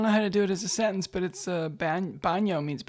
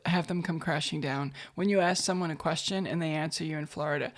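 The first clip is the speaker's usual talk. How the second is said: normal talking voice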